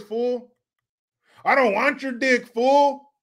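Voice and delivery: put-on Chicano homeboy accent. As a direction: protest in a sing-song Chicano accent. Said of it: "I can do that accent so well".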